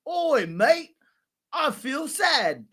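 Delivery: loud, high British caricature, drawn out; mock British accent. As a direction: put on an exaggerated British accent. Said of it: "British accent".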